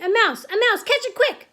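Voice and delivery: high, loud, urgent shouts; shouting. A narrator's reading. Kitchen staff chasing a mouse, shouting. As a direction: rouse everyone to the chase. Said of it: "they were shouting".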